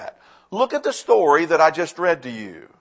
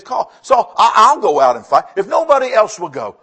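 1 eager volunteer; 3 semitones higher, 8 dB louder than usual. Retold or imitated. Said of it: "he says".

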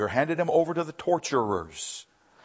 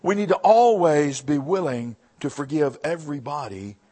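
usual speaking voice

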